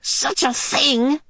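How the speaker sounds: a grunt of effort on the words. Acted. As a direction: strain as if pulling on a boot. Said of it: "she grunted".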